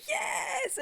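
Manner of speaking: a high excited yes; cheer. Real, not acted. Fan cheering as the brother is pulled back, high cheer.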